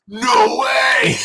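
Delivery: high, loud no way; shocked shout. Retold or imitated. Told someone wants to talk to him, loud shocked yelp. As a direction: yelp it out, star-struck. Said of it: "I'm like".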